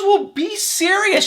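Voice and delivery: high, drawn-out mock retort; exasperated mom. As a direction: imitate a mother snapping back in disbelief. Voicing mom's retort to son.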